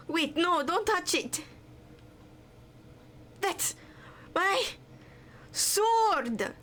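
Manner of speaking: high, panicked shout to stop him; alarmed cry. Acted. Character yelling at a thief not to touch the sword, high panicked cry. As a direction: yell it as your sword is grabbed.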